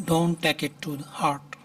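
very slow, each word spelled out for learners; slow teacher demonstration; slow model reading. Shown as a demonstration. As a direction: read it out slowly so students can copy. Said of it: "teacher models the English sentence".

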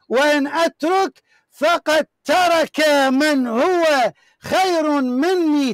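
every word drawn out slowly to weigh it; slow, deliberate recitation of a quoted text; slow emphatic reading. Acted. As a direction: read the quote slowly so each word lands.

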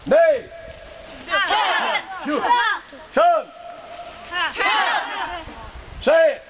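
shouted dojo commands; shouted drill count. Real, not acted. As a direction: call the count like a sensei.

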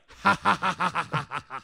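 high, creepy imitated billionaire cackle; evil laugh. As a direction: cackle like a cartoon villain. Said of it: "it's an evil laugh".

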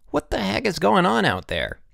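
high, irritated call; irritated cry. A narrator's reading. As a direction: call out in annoyance down a hall. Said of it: "cries of".

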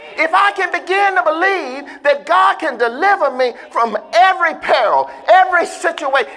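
loud, rising preacher's shout; preaching at full force. Acted. Preacher firing up the church, at full shout. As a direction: whoop it up to the congregation.

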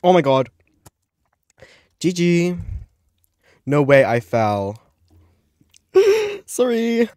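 loud shocked outburst; shocked shout. Real, not acted. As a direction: blurt it out in real shock. Falls out of game, shocked.

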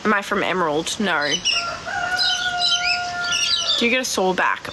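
normal talking voice

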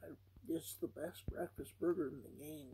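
ordinary delivery

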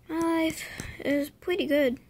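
ordinary talk, nothing marked

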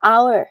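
hour starting on the vowel, no H; silent H. Shown as a demonstration. Teacher giving the second example to students, hour with silent H. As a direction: drop the H in hour completely. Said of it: "สองคำเนี้ยไม่ต้องออกเสียงตัว H".

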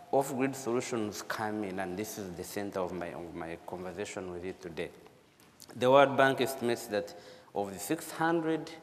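ordinary delivery

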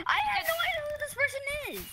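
very high-pitched and squeaky. Real, not acted. Game results appear.

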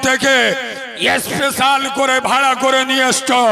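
loud sermon roar, hammering out each word; fiery preaching. Acted. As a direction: thunder the list at the crowd like a preacher. Waz preacher at full force.

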